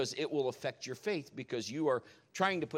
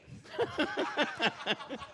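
breathy laugh; 12 semitones higher than usual. Real, not acted. Laughing at the dog.

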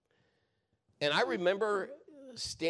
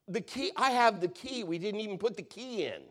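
excited protest; 8 semitones higher than usual. Retold or imitated. Manager protesting the key unused.

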